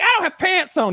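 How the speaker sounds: high mock woman protesting; whiny woman. Guest imitating the hotel woman protesting, high and whiny. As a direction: squeal it like a stalling woman.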